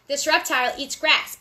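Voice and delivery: usual speaking voice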